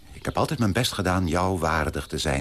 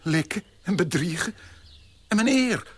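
much higher, scandalised cry; outraged; 11 semitones higher than usual. Acted. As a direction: shriek in prim indignation. Outraged fiancé. (hoog, verontwaardigd)

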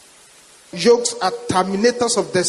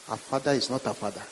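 quiet; 10 semitones lower, 11 dB quieter, faster than usual. Retold or imitated. Pastor repeats the spirit's words.

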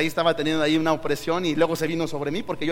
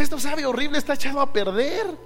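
high, loud protest at a bad taste; retold disgusted complaint; 7 semitones higher, 4 dB louder than usual. Pastor to Isaí after tasting the dessert, appalled. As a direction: exclaim in appalled disbelief, pitched up.

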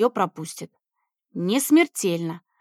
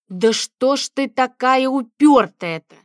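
frustrated; 3 semitones higher, 5 dB louder, slower than usual. Acted. Frustrated at ignored calls.

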